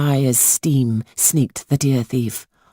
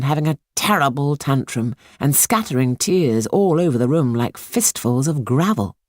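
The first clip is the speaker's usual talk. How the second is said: ordinary delivery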